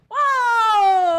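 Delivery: high, loud startled whoa; shocked whoa. Retold or imitated. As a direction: burst out in shock. Shocked reaction in retold hookup.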